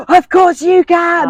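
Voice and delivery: very high, slow, emphatic squeal; all in capitals. Retold or imitated. Laura's reply to his request, read as an emphatic squeal. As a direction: stretch it out like a shouted text message. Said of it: "all in capitals".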